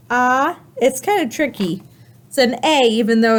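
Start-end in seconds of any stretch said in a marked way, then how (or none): none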